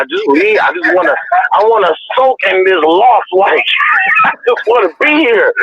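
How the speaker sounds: high, whiny imitation of his younger self; sulky teen. Young boxer telling his mom to leave him alone, whiny sulky.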